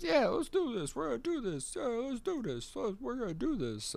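high goofy cheering crooks; excited henchmen. Retold or imitated. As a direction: pump up the gang, high and silly. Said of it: "all the guys are like".

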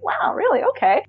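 thrilled disbelief at the praise; surprised delight. Retold or imitated. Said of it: "And I'm like".

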